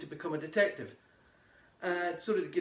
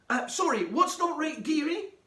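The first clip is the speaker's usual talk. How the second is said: airy mum; 11 semitones higher, 6 dB louder than usual. Acted. Mum voiced in the story.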